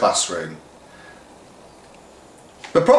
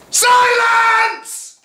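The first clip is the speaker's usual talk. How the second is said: a shrill, very high screamed "Silence!"; teacher's loudest ever shout; 5 dB louder than usual. Acted. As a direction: scream it over the whole hall. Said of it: "Miss Watkins shouted".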